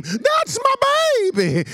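high, loud, drawn-out shout; proud mama scream. Retold or imitated. Mother screaming proudly from the stadium crowd. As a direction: scream it from the stands. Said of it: "I hear my mama scream".

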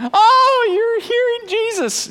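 very high, drawn-out gullible amazement; mock awe. Congregant believing the pastor hears Jesus, mock awe. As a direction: gasp it out like a dazzled believer, hammed-up.

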